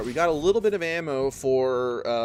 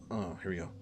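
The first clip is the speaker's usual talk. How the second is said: usual speaking voice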